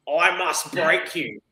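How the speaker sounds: very slow, deliberate movie-villain impression; Ivan Drago. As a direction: draw out the tough fighter's threat. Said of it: "Ivan Drago with a pin up his ass".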